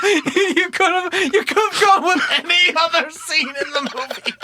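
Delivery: real laughter through a playful jab; laughing teasing. Real, not acted. Host teasing Tim while laughing hard.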